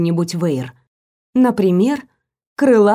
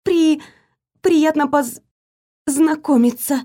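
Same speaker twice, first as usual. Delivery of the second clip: high, halting frightened stammer; frightened babble; 7 semitones higher than usual. A narrator's reading. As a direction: stumble over the words in fright. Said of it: "пролепетала я вслух, но так тихо". (испуганный лепет)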